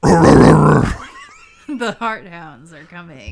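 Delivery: booming, slow, laughing announcement; dramatic shout. Pretend. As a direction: boom it out slowly through a laugh. Dramatic chapter-ending line.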